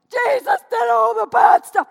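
loud, high mocking shout; mock boast. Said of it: "mock quote of the boaster".

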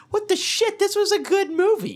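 very high-pitched mock astonishment; amazed retold reaction. Telling friends his first reaction to the movie, amazed. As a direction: squeal it, stunned and delighted.

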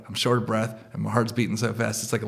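normal talking voice

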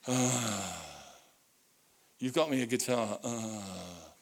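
put-on low, sighing teen groan; sulky teenager. Dad imitating his son getting a guitar, sulky groan. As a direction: groan it like a put-out fourteen-year-old. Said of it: "that look that teenagers do at fourteen".

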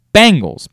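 "Bangles" with a clear bang at the start; like the band. Shown as a demonstration. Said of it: "B, like the band".

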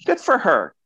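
approving. Retold or imitated. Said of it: "And I'm like".

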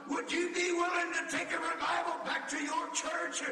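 shouted, high altar call, half-sung; full-force preaching. Acted. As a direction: plead with the whole congregation, full voice.

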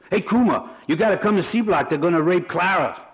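high, fast, loud panic; urgent alarm. Acted. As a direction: burst in breathless with alarm. Prisoner rushes in with alarm.

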